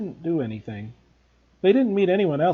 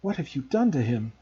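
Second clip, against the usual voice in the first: usual speaking voice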